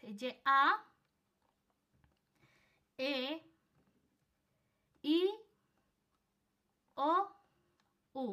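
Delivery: each vowel আ, এ, ই, ও, উ said alone with long pauses; spacing the vowels out for learners; vowel recital. Shown as a demonstration. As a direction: model the five vowels one at a time. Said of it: "vocal হয়েছে এই পাঁচটা letters".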